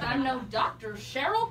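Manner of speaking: ordinary talk, nothing marked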